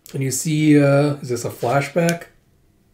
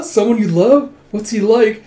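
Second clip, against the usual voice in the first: excited; 7 semitones higher, 6 dB louder than usual. Retold or imitated. Curious friend asking.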